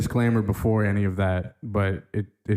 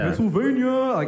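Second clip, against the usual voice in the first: mock formal; 11 semitones higher than usual. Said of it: "People, like, represent Philadelphia".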